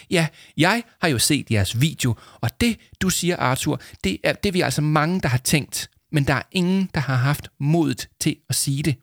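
high-pitched, emphatic admiration; gushing, moved teacher. A narrator's reading. Teacher praises pupils' brave video, deeply moved. Narrator voices emotional teacher Kirsten.

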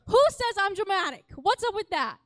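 playful fake offense; mock indignant. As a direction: protest it, hammed-up and loud. Defends herself against being dramatic.

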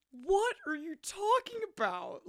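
high, slow, put-on tearful wail; mock sobbing. Imitating the sobbing boyfriend in the film, high mock wail. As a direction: wail it like a heartbroken teen. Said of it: "he's sobbing".

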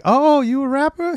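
high, drawn-out "oh", rising question; mock intrigued question. Woman eyeing the rapper in retelling.

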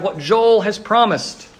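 ordinary talk

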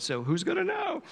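high, playful "who's gonna know"; cheeky aside. Real, not acted. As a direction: toss it off like a sly secret. Mischievous aside about secret ballet.

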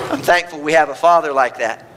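ordinary delivery

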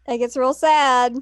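loud, playful echo; mock-emphatic echo. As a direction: repeat it back loudly for effect. Listener echoes line emphatically.

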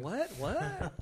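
puzzled listener can't follow; confused callers. Pretend.

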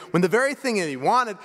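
ordinary talk, nothing marked

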